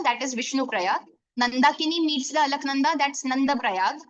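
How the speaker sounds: ordinary delivery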